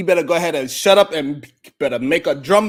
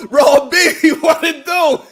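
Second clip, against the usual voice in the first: laughing shout; 6 semitones higher, 9 dB louder than usual. Real, not acted.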